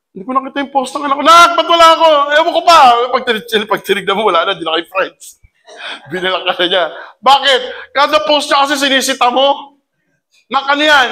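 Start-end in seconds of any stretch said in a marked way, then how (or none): 0.1-2.8 higher, louder and fast, caught off guard; panicking parent who can't see the post; startled parent. Retold or imitated. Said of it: "nagugulat ka na lang". 10.5-11.1 nagging parent. Retold or imitated. Parent scolding child's post.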